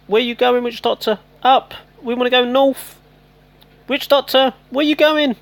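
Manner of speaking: high, loud calls after a wandering troop; shouting at a unit that won't listen; frustrated shout. Real, not acted. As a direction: yell at him to come back. Yelling at stray game troop.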